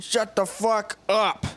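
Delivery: hammed-up exasperated silencing; mock furious retort. Owner silencing his talkative penis, mock fury. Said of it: "You're like".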